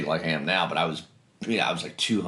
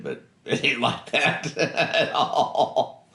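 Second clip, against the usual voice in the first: slow words broken by real laughter; real laughter while saying it; laughing; 6 semitones higher, much slower than usual. Real, not acted. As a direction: laugh through it at how naive that was. Laughs at his own naive hopes.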